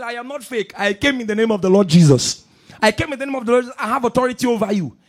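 loud, forceful declaration of authority; shouting back. Retold or imitated. Pastor answering the witch, shouting his authority. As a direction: shout it down with conviction. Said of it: "he kept shouting".

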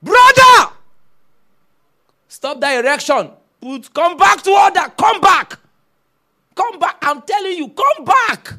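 fiery preacher scolding his congregation; preaching shout. Real, not acted. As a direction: call the brother back to order with full force. Preacher rebuking lust at full force.

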